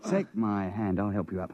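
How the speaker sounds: normal talking voice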